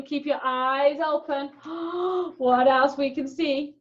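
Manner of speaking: ordinary talk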